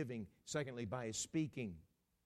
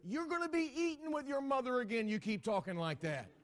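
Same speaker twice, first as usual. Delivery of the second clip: high-pitched scolding wife; mock marital threat; indignant retort; 12 semitones higher, 7 dB louder than usual. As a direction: fire back a warning as an offended wife. Wife retorts to critical husband.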